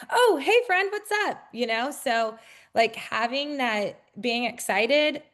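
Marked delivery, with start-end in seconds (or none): 0.1-1.4 high, sing-song hello to a friend; much higher, chirpy welcome; bubbly realtor hello; cheery greeting. Retold or imitated. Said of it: "I'm like".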